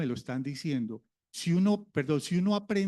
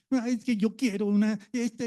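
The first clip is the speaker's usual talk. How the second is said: a hint of a child whining, a little higher; whiny child; 4 semitones higher than usual. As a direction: mildly mimic a child whining for something. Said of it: "y chilla y llora". (niño lloriqueando levemente imitado)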